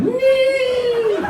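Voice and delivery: very high piercing squeal like a kettle; kettle whistle squeal. Retold or imitated. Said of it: "Like a kettle whistling".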